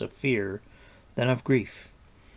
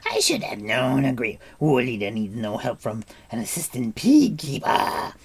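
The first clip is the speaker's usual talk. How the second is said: howling Gurgi; 3 semitones higher, 5 dB louder than usual. A narrator's reading. Gurgi wailing about the pig keeper, howling. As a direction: howl it out as an aggrieved creature. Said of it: "Gurgi began howling at the top of his voice".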